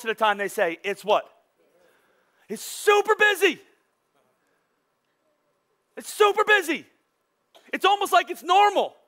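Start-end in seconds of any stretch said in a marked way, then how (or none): 2.5-3.6 exasperated. Retold or imitated. Quoting typical reply. 6.0-6.8 exasperated. Retold or imitated. Repeat of quote.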